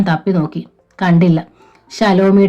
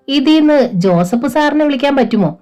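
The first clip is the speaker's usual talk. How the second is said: hopeful; 7 semitones higher than usual. A narrator's reading. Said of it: "ആശയോടെ Shalomi ചോദിച്ചു".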